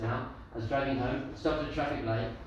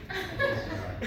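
laughing. Retold or imitated. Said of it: "he was like".

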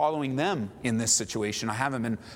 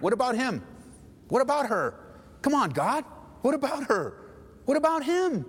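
high, insistent repeated questions; much higher, mock-aggrieved protest; indignant complaint; 12 semitones higher than usual. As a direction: whine upward to God about someone else. Complaining to God about enemies.

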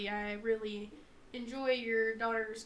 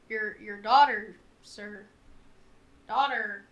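halting, loud on daughter; stammering nervous repeat; 11 dB louder, slower than usual. Acted. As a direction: stumble over the word daughter, slow. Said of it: "And he's like".

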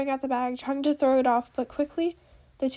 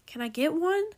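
higher, rising, eager demand; almost shouting; 5 semitones higher, 3 dB louder than usual. A narrator's reading. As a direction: push the question up, just short of shouting. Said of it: "Knowledge almost shouted".

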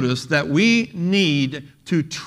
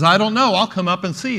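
raised, higher mock call; retold yell back; 5 semitones higher, 5 dB louder than usual. Ninety-four-year-old sister answering from downstairs, mock yell. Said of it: "yelled back to her".

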